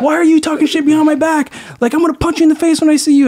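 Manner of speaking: hammed-up furious skater; angry coworker. Coworker threatening to punch him, mock furious. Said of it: "He's like".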